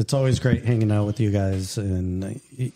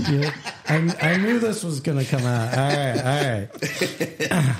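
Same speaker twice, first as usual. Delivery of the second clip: laughing; 3 semitones higher, slower than usual. Real, not acted. Laughing at being outed.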